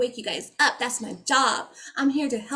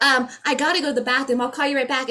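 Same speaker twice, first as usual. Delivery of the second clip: fast, slightly louder and higher; hurried excuse; 4 semitones higher, 3 dB louder, faster than usual. Retold or imitated. A friend trying to end the call, hurrying. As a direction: rush it out to hang up. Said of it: "It's like".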